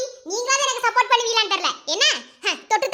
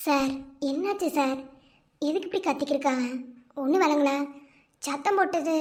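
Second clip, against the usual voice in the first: much lower and softer, hesitant; timid and soft; 9 semitones lower, 5 dB quieter than usual. Acted. Worried guest asking why the family is shouting, softly. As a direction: speak small, afraid to intrude.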